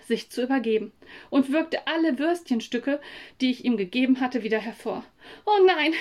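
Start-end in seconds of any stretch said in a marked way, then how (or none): 5.4-5.9 höher und lauter, erschrocken rufen; oh nein, laut ausgerufen; erschrockener Ruf. Acted. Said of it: "rief ich". (Samu ruft erschrocken beim Anblick des Hundes)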